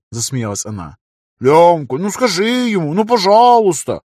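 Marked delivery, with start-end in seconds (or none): 1.4-4.0 high, loud comic whining plea; big guy's voice. Retold or imitated. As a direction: beg like an overgrown child. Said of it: "изобразить голос здоровяка".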